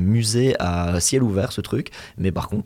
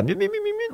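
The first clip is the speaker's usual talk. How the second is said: tiny high-pitched mewing imitation; very high mock creature noises; mimicking little creature; 3 dB quieter than usual. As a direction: squeak "miou" over and over like a little creature. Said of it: "il fait les petites mimiques".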